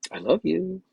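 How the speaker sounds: lifted love you. Shown as a demonstration. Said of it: "there's different ways to share the word love".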